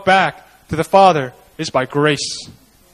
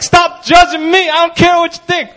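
a lightly raised voice telling off judgmental churchgoers; defiant retort; 8 semitones higher than usual. Retold or imitated. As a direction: tell them off for judging you. Retorting to judgers.

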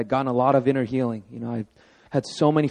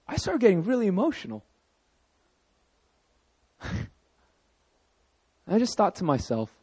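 usual speaking voice

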